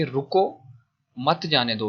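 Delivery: रुको left hanging, a pause, then मत जाने दो in one group; pause after रुको. Shown as a demonstration. Teacher explaining that he must not be let go, pause after रुको. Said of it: "मतलब उसको जाने नहीं दो".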